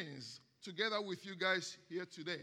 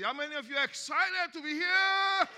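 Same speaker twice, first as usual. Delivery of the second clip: excited call; 7 semitones higher, 7 dB louder than usual. Real, not acted. Pastor rousing the congregation.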